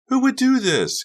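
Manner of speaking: very high, loud appalled question; Carrie repeating, appalled. Acted. Carrie repeats her question to Becca, very high and loud. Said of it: "Carrie repeated".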